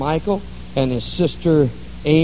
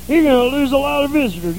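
lightly pinched nasal mock of the deacon; a hint of a stuffed-up nose; nasal deacon impression; 6 semitones higher than usual. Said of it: "two carrots stuck up each nostril".

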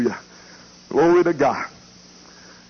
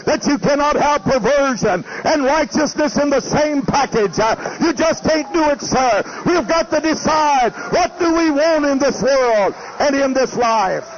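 preaching at full force; 9 semitones higher than usual. Real, not acted. Preacher warning his congregation against sin, full-force preaching. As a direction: hammer each warning at the congregation.

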